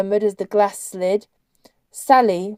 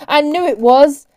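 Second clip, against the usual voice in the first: loud, raised-pitch shout; higher, forceful call; 6 semitones higher, 9 dB louder than usual. A narrator's reading. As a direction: shout it out over the babble. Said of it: "Ned was shouting".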